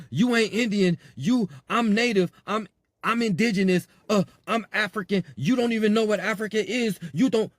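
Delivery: mock heated argument. Vlogger imitating people squabbling over identity, hammed-up heat. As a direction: ham up a petty identity squabble.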